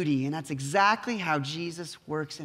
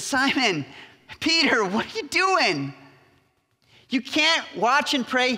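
higher-pitched, drawn-out mock reproach; exasperated reproof; 5 semitones higher, slower than usual. Jesus finding Peter asleep, exasperated reproof. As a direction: chide a friend who has let you down.

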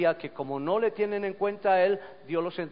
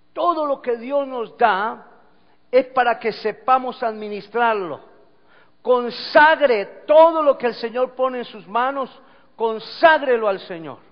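loud, raised, emphatic preaching; predicación a plena voz; 6 semitones higher, 12 dB louder than usual. Real, not acted. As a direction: exhort the congregation forcefully. Preaches at full force. (predicar con fuerza, en voz alta)

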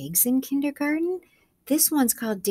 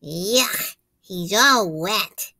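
disgusted; 4 dB louder, much slower than usual. Acted. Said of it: "said Daisy".

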